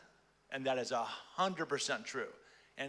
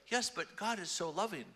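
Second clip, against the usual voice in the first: quick eager objection, a bit higher; hasty reassurance; 5 semitones higher than usual. Retold or imitated. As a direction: blurt the comforting but. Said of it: "you want me to say very quickly".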